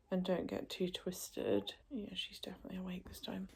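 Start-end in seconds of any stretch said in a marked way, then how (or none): 1.9-3.5 quick unvoiced whisper; hurried breathy whisper; fast whisper. Real, not acted. Mother whispering that the baby is awake.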